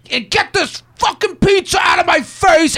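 a high, hammed-up scream of rage; an acted outburst at the roommate over the pizza; mock furious shout. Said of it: "you go".

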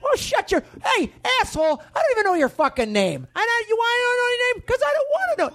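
very high, strained yelling; furious. Acted. Bandleader insulting nameless bandmate, high furious yell. Acted quarrel with band.

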